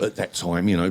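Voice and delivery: normal talking voice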